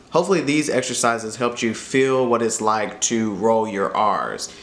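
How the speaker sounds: ordinary delivery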